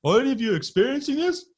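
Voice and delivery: high, loud panicked mimicry; mock-panicked, pitch shooting way up; imitating scared man. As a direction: yelp it as the freaked-out guy. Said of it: "he was sounding scared and".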